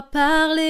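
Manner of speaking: PARLÉE held long and loud on its last syllable; drawn-out PARLÉE. Shown as a demonstration. Teacher introducing spoken chest voice, stressed and drawn-out PARLÉE. Stretches the word while explaining spoken voice.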